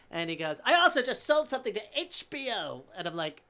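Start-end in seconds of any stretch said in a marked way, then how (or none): 0.7-2.8 high, loud name-dropping; flamboyant boasting. Retold or imitated. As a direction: brag grandly as the showy old man. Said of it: "so extravagant and flamboyant".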